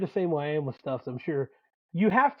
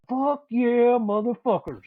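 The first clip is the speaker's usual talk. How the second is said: retold hyped cheer, higher and louder; hyped celebration; 6 semitones higher, 4 dB louder, slower than usual. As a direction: relive a pumped-up win, words stretched out. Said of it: "so hyped".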